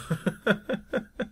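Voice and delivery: short burst of high-pitched laughter. Real, not acted. Vlogger admitting they don't know, high laugh.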